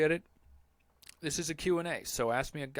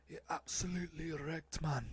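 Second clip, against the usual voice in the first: put-on London footballer accent; 3 semitones higher, 4 dB quieter than usual. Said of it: "trying to sound like a ... footballers from London".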